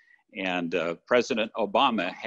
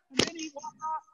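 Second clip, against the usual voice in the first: very high, softer punchline; excited climax; 6 dB quieter than usual. Retold or imitated. Preacher ending the story of the pastor's exit, excited high pitch. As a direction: stay up at the peak pitch but drop the volume.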